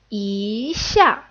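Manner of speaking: "yi" drawn upward, then "xia" falls sharply; second tone yi. Shown as a demonstration. Teacher shows the changed tone, "yi" rising. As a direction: let "yi" rise into a falling "xia". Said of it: "gets changed into the second tone".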